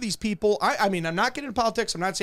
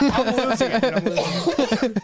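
laughing. Real, not acted. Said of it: "I'm like".

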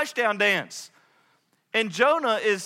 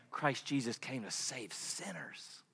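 whisper; 8 semitones lower, 15 dB quieter than usual. Real, not acted. Whispered scripture climax.

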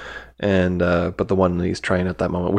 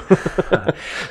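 breathy laugh; 9 semitones higher than usual. Real, not acted. Laughs at his own joke.

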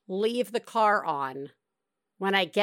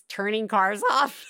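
ordinary talk

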